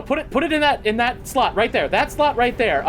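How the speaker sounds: high, loud, flustered rush of words; acted alarm. Game master voices alarmed onlookers.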